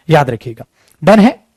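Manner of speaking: ordinary talk, nothing marked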